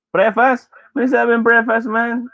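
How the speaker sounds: high, incredulous mock cringe at preface. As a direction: squeal it up high as if appalled. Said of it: "Cringe ako sa nagsasabi ng preface".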